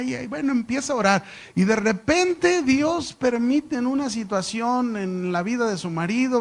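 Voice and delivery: ordinary delivery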